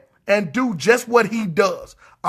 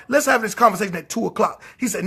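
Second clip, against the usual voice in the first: ordinary talk, nothing marked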